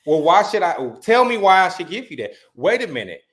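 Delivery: usual speaking voice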